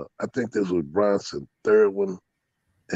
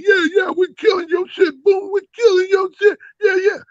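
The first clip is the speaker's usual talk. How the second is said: gloating rivals. Retold or imitated. Rival dog owners taunting him ringside, high crowing shout. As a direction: crow like cocky rivals sure they've won.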